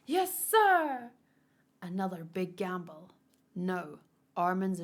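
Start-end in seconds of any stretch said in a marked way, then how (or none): 0.1-1.1 loud, high military yes; shouted salute. Acted. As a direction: bark it like a soldier. Soldier answers order loudly.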